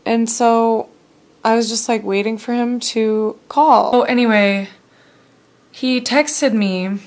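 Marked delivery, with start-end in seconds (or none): none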